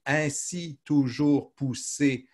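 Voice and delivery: each syllable laid out slowly; slow verse recital. Shown as a demonstration. Teacher reciting Lamartine to a student, slow and measured. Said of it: "Si je dis".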